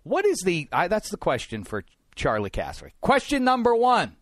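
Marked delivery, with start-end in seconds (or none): 3.0-4.2 loud, high-pitched pundit's topic call; McLaughlin. Acted. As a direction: boom it out like a TV panel host. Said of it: "do it like that".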